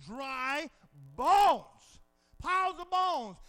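loud, punched word; emphatic shout. Real, not acted. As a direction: bellow the word bones.

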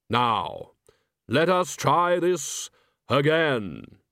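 slow, grave Death; measured, solemn skeletal voice. Acted.

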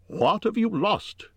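high-pitched, insistent question; persistent. A narrator's reading. Hobbit keeps asking what the creature lost, insistent. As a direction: press the question, refusing to drop it. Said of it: "Bilbo persisted".

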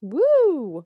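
high, loud "woo"; excited end-of-show cheer; celebratory whoop. Real, not acted.

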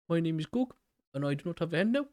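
high-pitched, badly faked Korg accent; terrible Korg accent. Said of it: "that is a terrible accent".

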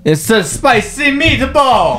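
a mock Italian cook; New York accent. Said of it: "Angry New York is easy".

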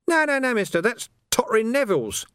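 high, sly, wheedling urchin voice; high-pitched cockney urchin hustling a copper; cheeky street urchin. Acted. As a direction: haggle like a grubby street kid. Urchin Nobby's voice.

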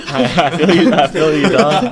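laughing through the words; slow, giggling agreement. Real, not acted.

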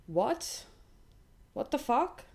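high, incredulous what the fuck; genuinely stunned outburst; shocked. Real, not acted. Stunned by the weird message.

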